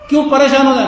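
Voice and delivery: ordinary talk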